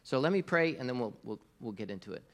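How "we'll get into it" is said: softer, hushed prayer voice. Acted. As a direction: pray quietly and sincerely. Opens a prayer.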